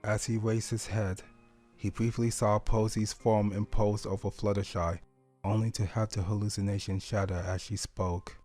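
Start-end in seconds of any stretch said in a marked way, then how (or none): none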